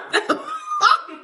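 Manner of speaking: a real shriek of laughter, way up high. Real, not acted. Telling the shrimp story to friends, shrieking laughter. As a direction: let a squealing cackle escape.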